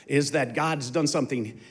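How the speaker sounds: usual speaking voice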